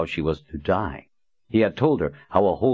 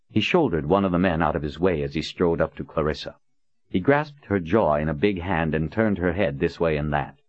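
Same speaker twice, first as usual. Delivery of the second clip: usual speaking voice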